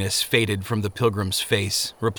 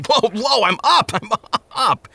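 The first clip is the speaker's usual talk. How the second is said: startled awake; 11 semitones higher than usual. A narrator's reading. Faithful splashed with water yelps awake, high and laughing. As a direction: jolt awake, half laughing.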